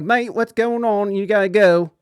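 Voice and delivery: British mate telling him to leave; British roommate. Retold or imitated. British roommate breaking up the yelling, ordering him out, mock British.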